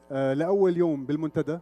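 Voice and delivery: usual speaking voice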